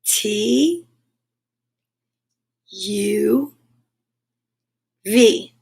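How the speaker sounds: letters stretched long, spaced by pauses; slow, lingering alphabet recitation; drawn out. Shown as a demonstration. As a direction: recite each letter slowly, drawing it out. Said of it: "draw it out".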